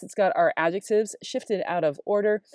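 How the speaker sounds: ordinary talk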